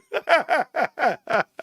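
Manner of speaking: long, high cackling laugh; high laughing fit. Real, not acted. Cracking up at his own Ape Father story, high laughing fit. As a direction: burst into helpless high laughter.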